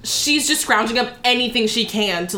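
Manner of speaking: usual speaking voice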